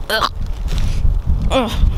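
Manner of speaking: short grunt of disgust; disgusted ugh. Real, not acted. Owner looking at his dirty car, disgusted groan. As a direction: groan in revulsion, twice.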